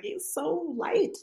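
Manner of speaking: put-on high, slow baby-marvelling coo; awed, high. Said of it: "a comment that we both kept saying".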